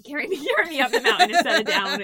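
giggling while joking; laughing. Real, not acted. Laughing joke about the mountain.

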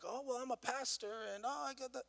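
mock self-important pastor. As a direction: puff yourself up as a pastor, half-mocking. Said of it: "I run around going like".

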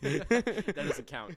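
high, loud, slow objection; loud protest. Real, not acted. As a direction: stretch out the protest. Protests jokingly.

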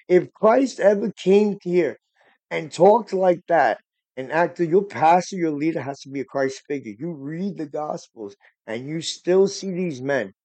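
impassioned preaching. Acted. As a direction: preach it like from the pulpit, pushing harder. Preaching with rising fervour.

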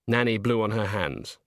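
ordinary delivery

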